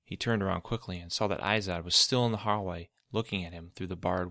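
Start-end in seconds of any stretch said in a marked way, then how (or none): none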